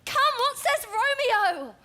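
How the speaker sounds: high, eager pleading; impatient. Acted. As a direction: beg urgently for the news. Juliet impatient for news.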